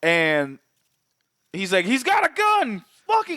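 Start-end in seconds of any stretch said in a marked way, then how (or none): 1.8-3.4 high, sharp cop shouting; mock cop yell. Cop yelling in retold scene.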